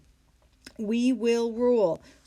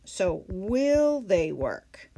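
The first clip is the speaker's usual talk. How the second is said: So will they work? said very slowly, word by word; slow model question; 2 semitones lower, much slower than usual. Shown as a demonstration. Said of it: "We move the helping verb first".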